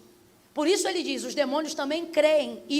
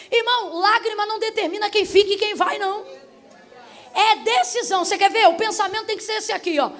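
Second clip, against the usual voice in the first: raised, high-pitched preaching voice; forceful preaching; 5 semitones higher, 6 dB louder than usual. Real, not acted. Preacher corrects a congregant who trusts tears, forceful preaching. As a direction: drive the point home to the congregation.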